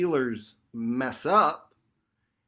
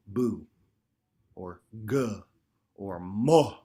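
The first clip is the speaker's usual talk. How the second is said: sample syllables; 4 semitones lower, slower than usual. Shown as a demonstration. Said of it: "You're gonna say one syllable".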